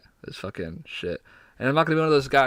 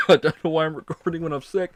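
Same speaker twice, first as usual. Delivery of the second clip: higher, louder words through laughter; laughing; 5 semitones higher, 4 dB louder than usual. Real, not acted. Host signing off while sick, laughing. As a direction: laugh at yourself while talking.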